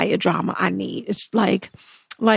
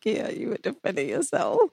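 dry muttered retort; 3 semitones lower, 10 dB quieter than usual. Retold or imitated. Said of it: "And I'm like".

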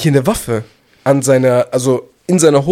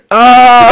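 mock yell; 6 semitones higher, 9 dB louder than usual. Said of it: "der macht nur noch".

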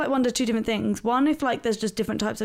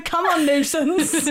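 laughing; 6 semitones higher, 4 dB louder, much slower than usual. Retold or imitated. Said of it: "it looks like I just went to".

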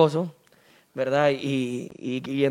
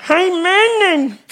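higher, very slow nasal-speech plea; nasal-speech guy; 12 semitones higher, much slower than usual. Acted. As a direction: drag it out, pleading through the nose. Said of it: "le dice el gangoso". (voz gangosa, lenta y aguda)

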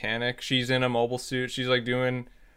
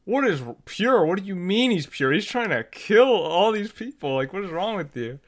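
high-pitched mock disbelief, imitating Amuro; incredulous Amuro; 6 semitones higher than usual. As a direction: throw the question back in disbelief, much higher. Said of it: "Amuro's like".

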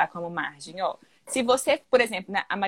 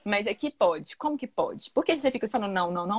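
normal talking voice

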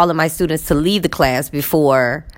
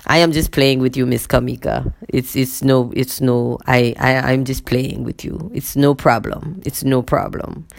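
imitating male colleague; 4 semitones lower than usual. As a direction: lower mimicked man's voice, halting repeats. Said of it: "then he says".

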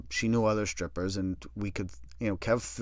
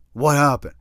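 louder urgent question; alarmed demand; 3 semitones higher, 8 dB louder than usual. Retold or imitated. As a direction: snap it out in shock. Kev presses again.

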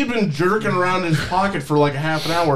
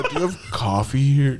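put-on old man; 4 semitones lower than usual. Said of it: "imitating the old man".

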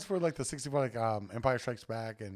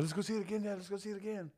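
higher-pitched pleading little boy, imitating the son; eager kid voice; 10 semitones higher, 3 dB quieter than usual. Father imitating his son begging to go again, high kid voice. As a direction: beg like a kid wanting to see the movie again.

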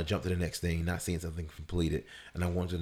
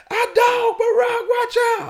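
high, loud shouted alarm; imitated warning yell; 13 dB louder than usual. Dog owners warn the walker of their pit bull, imitated high yell. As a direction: holler a hammed-up urgent warning. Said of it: "start yelling for me".

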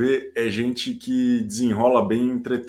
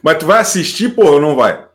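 loud demand; 5 semitones higher, 10 dB louder, faster than usual. Real, not acted. Host calling out to guest.

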